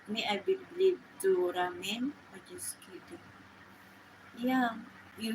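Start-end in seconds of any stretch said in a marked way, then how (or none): 2.3-3.2 breathy whisper, far softer; whispered aside. Real, not acted. Teasing the listener about Ramin, in a soft whisper. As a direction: drop to a quick teasing whisper.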